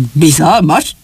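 wary questioning echo; suspicious. A narrator's reading. Old shoemaker taken aback by the stranger, suspicious. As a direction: recoil slightly and query the word. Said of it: "Drága Jó gyanakodva hátrahőkölt".

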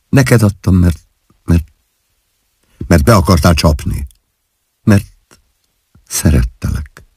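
hesitant, broken admission; halting confession. A narrator's reading. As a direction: falter on the reason, with pauses. Wife hesitantly confesses her love.

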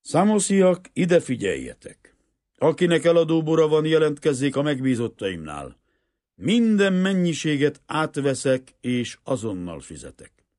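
high, loud call to a crowd; megaphone announcement. A narrator's reading. Banker addresses the crowd on the shore, loud proclamation. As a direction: proclaim it as if through a loudhailer. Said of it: "átvette a hajó kapitányától a hangtölcsért".

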